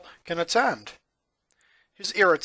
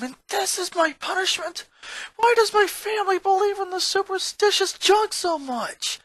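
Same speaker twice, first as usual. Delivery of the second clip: ranting; 11 semitones higher than usual. A narrator's reading. Boy ranting alone about his superstitious family, angry and high. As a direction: vent it all out in a fury. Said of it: "he ranted".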